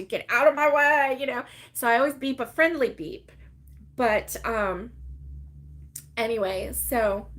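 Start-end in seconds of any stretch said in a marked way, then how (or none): 0.0-1.2 louder, pushy mock shout; mildly hollered impatience; mock impatient yell. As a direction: act out a honking driver's demand. Voicing an imagined rude honking driver.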